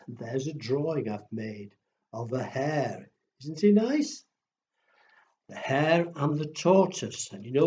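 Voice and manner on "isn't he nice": ordinary delivery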